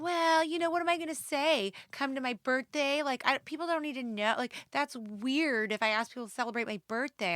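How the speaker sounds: mock Abby. Friend Abby quoted defending herself.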